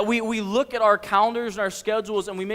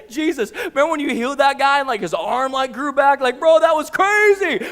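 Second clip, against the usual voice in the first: rowdy excitement building higher and louder; losing their mind, joking; 5 semitones higher, 6 dB louder than usual. Acted. Disciple joking with Jesus about a healing, excited and laughing. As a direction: crack up over a wild memory with a buddy. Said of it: "they're losing their mind".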